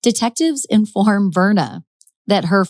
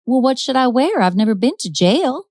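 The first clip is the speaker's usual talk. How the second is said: put-on higher, lilting Southern belle accent; 6 semitones higher than usual. [name] to arresting detectives, hammed-up Southern accent. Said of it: "That's my best Southern accent".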